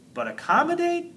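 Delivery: high, disbelieving question; incredulous. A narrator's reading. Incredulous at hard word.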